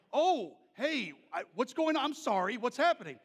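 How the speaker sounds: flustered apology; alarmed. Retold or imitated. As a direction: blurt out worried questions. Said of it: "And I was like".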